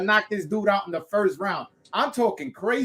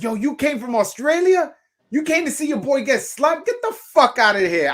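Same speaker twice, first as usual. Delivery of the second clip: trash talk; 9 semitones higher, 4 dB louder than usual. Retold or imitated. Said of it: "I was like".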